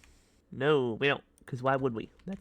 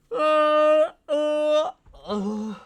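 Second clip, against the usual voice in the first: mock loud stammer; 14 dB louder, much slower than usual.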